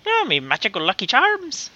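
lightly voiced Lucky Charms leprechaun in Irish accent; hammed-up Irish leprechaun accent; mock Irish accent. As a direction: tease with a cartoon Irish accent. Mocking the Irish cereal mascot.

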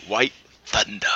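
low, slow whisper. Acted. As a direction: breathe the name reverently. Savoring a silly username.

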